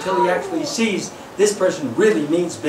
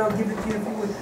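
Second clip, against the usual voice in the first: calm parent; 8 dB quieter, much faster than usual. Retold or imitated. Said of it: "I said".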